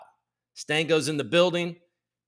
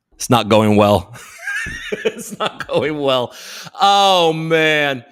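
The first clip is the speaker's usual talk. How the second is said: helpless laugh through the words; slow, loud words breaking into laughter; 9 dB louder, much slower than usual. Real, not acted. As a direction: crack up while saying it. Cracks up at his own joke.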